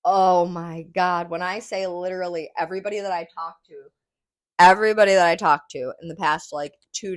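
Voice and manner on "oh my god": ordinary delivery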